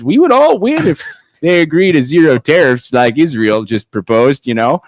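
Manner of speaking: a Canadian accent that breaks into laughs. Shown as a demonstration. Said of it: "in a Canadian accent".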